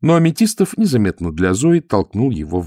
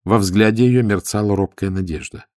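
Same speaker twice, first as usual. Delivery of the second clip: ordinary delivery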